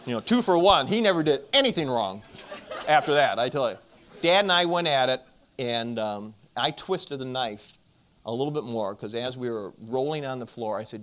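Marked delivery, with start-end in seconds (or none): 0.1-5.2 laughing through the punchline; loud and laughing as the joke lands; telling the audience the punchline, laughing loudly. Real, not acted.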